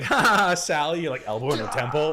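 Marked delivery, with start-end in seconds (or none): 0.0-1.0 mock fake laugh. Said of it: "You're just like".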